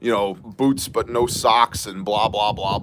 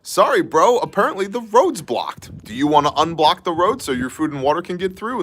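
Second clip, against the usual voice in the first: higher, overly nice Canadian cop voice; mimicking a polite Mountie talking to truckers; mock polite cop; 6 semitones higher than usual. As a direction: play the cop as sweetly reasonable. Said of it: "the R C M P was like".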